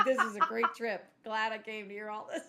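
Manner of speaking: laughing, loud sarcastic quote; mock delight breaking into laughter; Jake. Retelling Jake's sarcasm while laughing.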